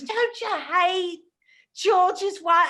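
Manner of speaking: put-on high, slow drunken English toff; Arthur impression. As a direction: slur it like a giddy drunk millionaire. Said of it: "doing my Arthur impression".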